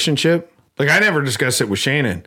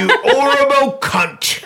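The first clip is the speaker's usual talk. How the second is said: very high, slow British insult; mock British accent; 12 semitones higher, slower than usual. Co-host topping her insult, mock British accent. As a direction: squeal it in hammed-up British.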